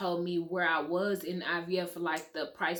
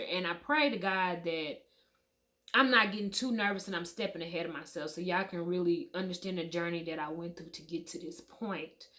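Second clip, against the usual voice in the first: usual speaking voice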